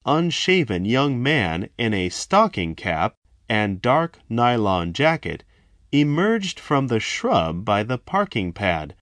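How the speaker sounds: ordinary delivery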